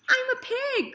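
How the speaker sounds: put-on very high, gleeful boy's shout; boy's cry. A narrator's reading. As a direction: yell it out with glee. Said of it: "cried Avery".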